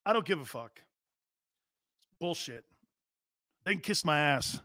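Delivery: sportscaster asks Baker about boos, he answers dismissively; Baker Mayfield impression. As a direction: shrug it off like you don't care. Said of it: "my impression of Baker Mayfield".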